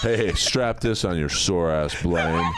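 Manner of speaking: Sonny Landham impression. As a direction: deliver the jab like a gruff commando. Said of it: "impression of Sonny Landham".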